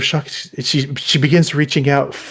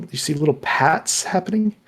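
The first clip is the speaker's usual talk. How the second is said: ordinary talk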